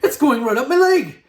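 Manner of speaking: high, loud panicked yell. A narrator's reading. Cook with a mouse climbing his leg, yelling. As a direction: yell it in high-pitched alarm. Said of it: "the man was yelling".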